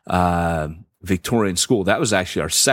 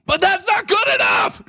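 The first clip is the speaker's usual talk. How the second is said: high, strained shout of protest; scream. Retold or imitated. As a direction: scream it out in frustration. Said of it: "I wanted to scream".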